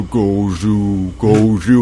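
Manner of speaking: low eerie creature call; Gozu monster sound. Retold or imitated. The strange noise from her body, low and eerie. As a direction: croak it like a lurking monster. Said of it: "the Gozu monster sound".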